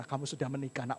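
low, soft fatherly reply; lightly voiced God. God answering the doubting husband as his child, lightly voiced and quiet.

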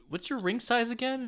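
high mock girl voice. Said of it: "it's like".

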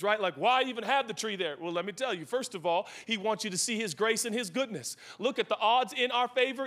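ordinary delivery

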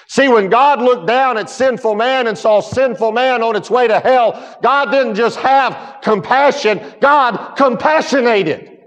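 high, loud, driving pulpit preaching; preaching at full force. Acted. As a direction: lift it and preach it hard to the congregation. Preaching climax on mercy.

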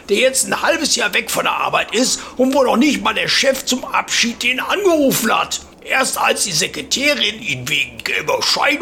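a know-it-all pensioner holding forth; old neighbour voice. Retold or imitated. Opa voiced lecturing at fence.

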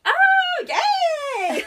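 very high, loud imitated cheer; mock delighted cheer. Husband finally understands the pregnancy hint, hammed-up delight. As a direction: squeal it like a husband who just caught on.

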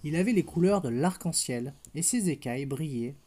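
put-on gentle storybook narration for a toddler; picture-book reading voice; bedtime story reading. A narrator's reading. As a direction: read it like a bedtime tale to a little girl. Reads bedtime story to child.

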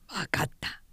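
hushed, clipped off; barely audible give-in; whisper. A narrator's reading. 曹操 cutting off, subdued. (ひそひそと)

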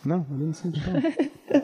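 laughing through the denial. Real, not acted. Friend says he snitched, he denies it laughing. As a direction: chuckle it out, brushing off the accusation.

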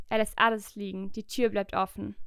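ordinary delivery

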